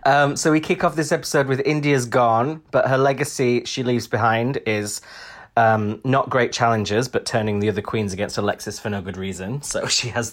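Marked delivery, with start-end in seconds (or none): none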